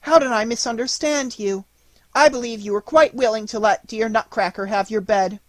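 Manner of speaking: put-on high-pitched, loud girlish voice; fervent cry. A narrator's reading. Marie apologising to dear Clara, high effusive cry. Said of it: "cried Marie".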